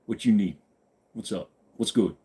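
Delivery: drawn "yo", low casual replies; low, laid-back greeting; answering in kind. Shown as a demonstration. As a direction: toss back street-style greetings. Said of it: "I have to answer back that way".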